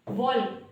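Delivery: वॉल्क with the L sounded before K; wrong pronunciation. Shown as a demonstration. Teacher listing mistakes for learners, L voiced in वॉल्क. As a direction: voice the silent L in वॉल्क. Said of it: "इस तरह से प्रोनाउंसिएट करना गलत".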